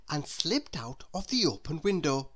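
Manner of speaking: ordinary delivery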